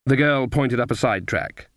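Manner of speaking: plain reading voice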